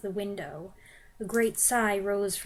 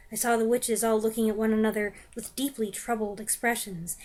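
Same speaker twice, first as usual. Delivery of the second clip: ordinary delivery, nothing marked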